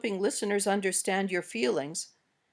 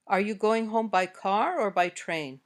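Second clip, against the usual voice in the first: up on "car", down on "train"; choice-question intonation. Shown as a demonstration. Teacher gives learners a choice question, rise then fall. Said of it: "Now say these sentences".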